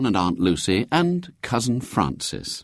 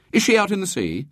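put-on high-pitched eager boy; animated; 12 semitones higher, faster than usual. A narrator's reading. William imagining his great-aunt sinking, animated. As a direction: ask with sudden lively interest. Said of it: "William's expression grew animated".

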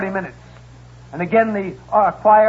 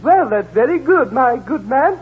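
raised prim lady voice; 7 semitones higher, 6 dB louder than usual. Acted. Customer to porter, a raised fussy lady voice. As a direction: play the fussy rich lady.

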